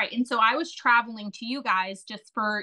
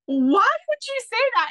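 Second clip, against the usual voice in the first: 1 startled husband; 13 semitones higher, 4 dB louder than usual. Retold or imitated. Husband's startled reply retold.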